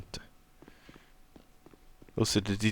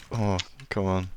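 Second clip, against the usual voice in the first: ordinary delivery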